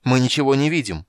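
loud, high desperate shout. A narrator's reading. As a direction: call out into the dark. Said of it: "прокричал Танис".

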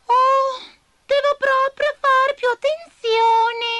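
high, loud, slow flustered muttering; confused grumble. Acted. Abigail flustered after her spell misfires. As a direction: fumble for an excuse. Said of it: "borbottò confusa Abigail".

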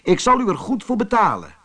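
storyteller voicing an eager-sounding buyer; rider's offer. A narrator's reading. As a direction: call the offer out briskly.

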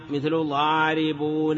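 normal talking voice

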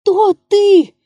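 very high, loud desperate shriek; desperate cry. A narrator's reading. Comedian repeating his question to the figure, desperate cry. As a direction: cry out in despair at the ghostly figure. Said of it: "повторил отчаянным голосом комик".

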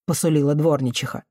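ordinary delivery, nothing marked

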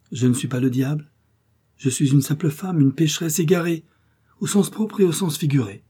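a hint of a tempting woman at the window; lightly voiced seductive woman. A narrator's reading. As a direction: play the lost woman, sweetly pleading.

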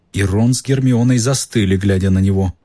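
plain reading voice

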